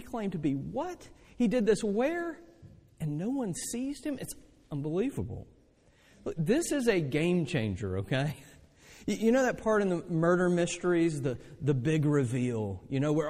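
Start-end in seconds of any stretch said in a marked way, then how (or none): none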